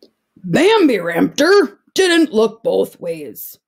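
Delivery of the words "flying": excited shout. Acted. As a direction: cheer it mid-jump. Dinosaur jumping on the bed.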